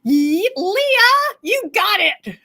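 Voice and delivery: excited shout. Real, not acted. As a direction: cheer the winner's name out. Winner announced in live sale.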